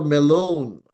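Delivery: "melon" with a falling glide at the end; rise then fall. Shown as a demonstration. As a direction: bend the pitch through the long vowel of "melon". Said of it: "pataas and then pababa".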